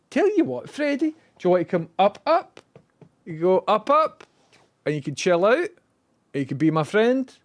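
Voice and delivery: high sing-song coaxing; coaxing the pet. Real, not acted. Owner coaxes Freddy up onto his lap in high baby talk. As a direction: lure the dog up sweetly.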